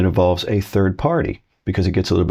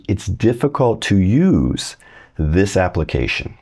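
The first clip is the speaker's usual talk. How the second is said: slow model sentence; slower than usual. Shown as a demonstration. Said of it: "What if we changed it around".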